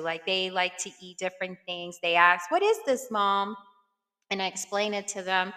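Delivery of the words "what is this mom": high-pitched imitation of a curious kid; curious daughters. Daughters asking mom about new food, imitated curiosity.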